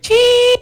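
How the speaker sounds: high sharp martial arts yelp; Kill Bill battle cry. Retold or imitated. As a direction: squeal it like a sword fighter. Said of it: "that Kill Bill moment".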